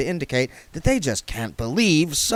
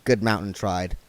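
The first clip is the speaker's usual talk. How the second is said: ordinary delivery, nothing marked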